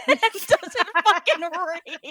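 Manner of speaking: words breaking into big laughter; loud laughing outburst. Real, not acted. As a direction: laugh hard through the line. Laughing at friend who doesn't read.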